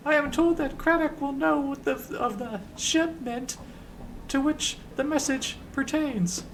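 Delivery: high falsetto, slowing formal explanation; Ben the Sender. Acted. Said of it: "in a high voice".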